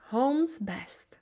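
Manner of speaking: whispered chapter title. A narrator's reading. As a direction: breathe the words softly. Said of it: "chapter title whispered".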